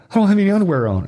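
high mock female voice; retold high feminine protest; imitated woman's voice. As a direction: pitch it up as the worried woman.

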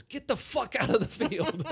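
imitating Walken yelling across a field, high and loud; angry yell. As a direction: bark it from far across the field. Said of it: "points to me and signals".

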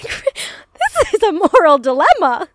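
high, slow, laughing protest. Real, not acted. Player protesting the childhood dog dilemma, laughing. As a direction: wail it through laughter, drawn out.

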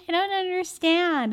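put-on high, slow drunk confusion; drunk confused. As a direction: play a tipsy twenty-year-old. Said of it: "a drunk twenty-year-old be like".

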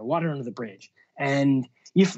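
ordinary talk, nothing marked

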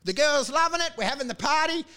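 high-pitched naive raving; mock gushing. Deluded traveller raving about the girls, high mock gushing. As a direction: gush like a deluded backpacker.

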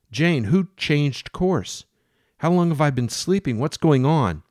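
captain shouting at Jane about the course; furious demands. Acted. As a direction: demand answers, alarmed and angry. Captain confronts Jane angrily.

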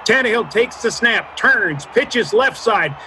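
rising excited radio call, shouting; touchdown call. Pretend. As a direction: build to a shout as he scores. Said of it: "I can't yell unless I've warmed up".

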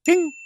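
high sung ding sound; imitated ding. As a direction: chime like a bell counter. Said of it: "a little ding, a counter".

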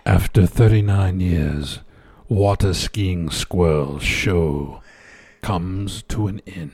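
low, slow mock-sad headline. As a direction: read it like a eulogy, slowly. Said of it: "It was sad the way I said it".

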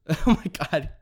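laughing through the words at a flubbed line; real laugh after a tongue twist; laughing at own slip. Real, not acted. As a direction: chuckle it out, amused at yourself. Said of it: "My tongue's twisted".

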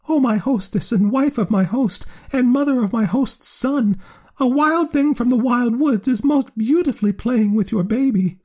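very high, tiny squeaking bat; squeaky bat voice. A narrator's reading. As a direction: pitch it way up like a little bat.

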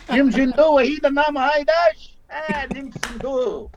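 high, laughing Brooklyn-accented Cree, hammed up; mock Brooklyn Cree. Actor mocking his own Brooklyn-accented Cree, high and laughing. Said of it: "she said I sounded like I was from Brooklyn".

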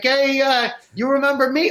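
a sketch of the friendly caller; imitating the seller. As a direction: put on the eager guy phoning again.

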